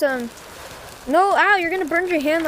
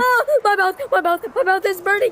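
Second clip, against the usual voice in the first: mock pain; 5 semitones higher than usual. Game character eats hot food.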